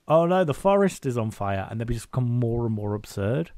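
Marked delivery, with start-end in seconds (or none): 0.1-1.7 hammed-up panic, higher and louder; mock alarm. Friend retelling the show's absurd script, mock alarm. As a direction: play it as a cheesy panicked actor.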